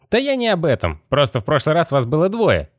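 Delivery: high, otherworldly skeleton voice. Acted. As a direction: pitch it way up like a rattling skeleton.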